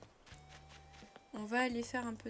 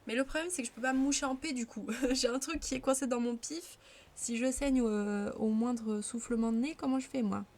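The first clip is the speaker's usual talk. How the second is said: exasperated; 3 semitones higher, 7 dB louder than usual. Real, not acted. Frustrated about nosebleed.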